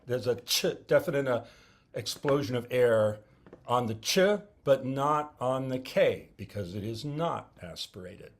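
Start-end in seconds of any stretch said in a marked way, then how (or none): none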